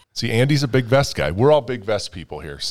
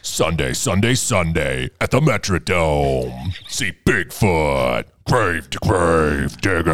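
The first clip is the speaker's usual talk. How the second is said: slow, lower hyped announcer voice; monster truck ad voice; 5 semitones lower, slower than usual. Retold or imitated. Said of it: "That's legitimately how they went".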